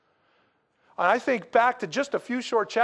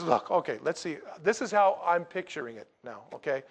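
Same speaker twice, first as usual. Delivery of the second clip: weary John; 6 semitones lower, 4 dB quieter than usual. Retold or imitated. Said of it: "So John says".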